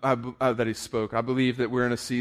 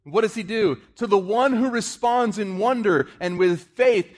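1 preaching at full force; 10 semitones higher, 6 dB louder than usual. Acted.

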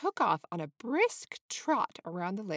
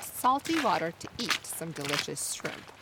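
ordinary delivery, nothing marked

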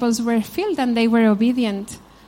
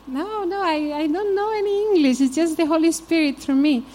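high-pitched quoted pastor; 5 semitones higher than usual. Retold or imitated. Pastor quoted replying humbly.

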